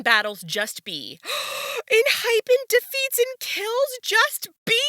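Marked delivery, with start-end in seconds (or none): 1.2-1.9 sharp, high intake of breath; shocked gasp. Real, not acted. Seeing Enhypen's battle result, shocked gasp. 1.9-4.7 very high, loud, incredulous question; shocked disbelief. Real, not acted. Fan reading that Enhypen killed Just B, shocked disbelief. Shocked by favourite group's death.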